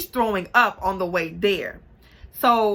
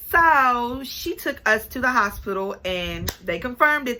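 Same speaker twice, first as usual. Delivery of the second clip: usual speaking voice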